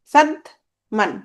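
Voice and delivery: Sadman in two slow beats, সাদ, then মান; split into syllables. Shown as a demonstration. Teacher counting syllables for students, Sadman split slowly in two. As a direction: break Sadman with a clear gap before মান. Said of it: "সাদমানে কয়টা অক্ষর".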